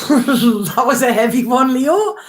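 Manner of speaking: high, laughing relief; relieved laughter through the words. Real, not acted. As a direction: laugh it off after a heavy one. Laughing after an emotional reading.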